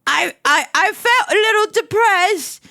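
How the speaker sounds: high, drawn-out melodramatic delivery; overdramatic reading. Acted. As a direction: ham it up with big emphasis. Overacted second take of fanfiction line.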